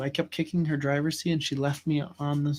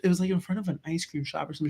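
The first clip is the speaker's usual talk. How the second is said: usual speaking voice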